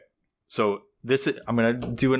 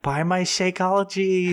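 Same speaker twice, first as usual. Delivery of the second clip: high, slow salesy pitch; MLM seller voice; 9 semitones higher, slower than usual. Acted. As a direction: sell it like a cheesy MLM rep.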